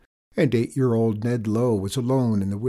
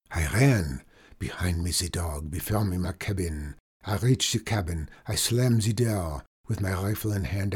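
French trapper; 3 semitones lower than usual. Acted. Trapper finishes his tale.

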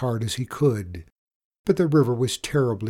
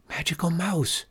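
whispered call; 8 semitones higher than usual. A narrator's reading. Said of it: "she whispered".

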